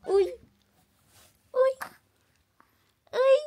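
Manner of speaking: loud short call; bright calls of hey. Real, not acted. Calling out to baby.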